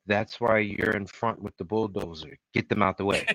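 soft, low quoted command; understated, quiet order; retold cold order. Retelling Kathleen's bulldozer order, quietly cold.

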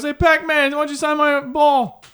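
high, eager fan voice; goofy fan. Retold or imitated. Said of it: "he goes".

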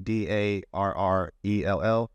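D A R R E L L spelled letter by letter, each letter clear and separate; spelling it out. Shown as a demonstration. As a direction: spell out D A R R E L L one letter at a time. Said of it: "my first name is spelled".

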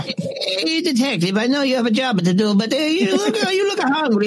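put-on high Italian old-woman voice, chuckling; a fussy old Italian lady stalling, with laughs; Italian granny. Old Italian killer offers the detective food, high accent.

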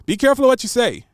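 stern warning. Real, not acted.

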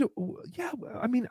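ordinary delivery

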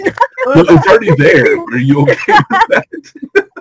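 high-pitched mock innocent voice; squeaky, giggly play-innocent question; imitating Star.

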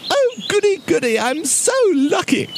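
high, delighted squeal of a bear; very high, excited storybook bear; gleeful little bear. A narrator's reading. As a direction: gush with joy in a high voice. Bear pretends delight at the giant.

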